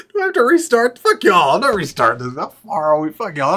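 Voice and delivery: loud, high-starting defiant rant; playful cursing at full volume; exasperated outburst. Real, not acted. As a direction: refuse loudly, half joking. Rants about restarting after blunder.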